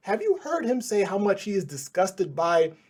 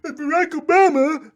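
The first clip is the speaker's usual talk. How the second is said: high, loud mock of the pundit's rant; mocking imitation; 10 semitones higher, 7 dB louder than usual. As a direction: ham up his yelling. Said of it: "of him going".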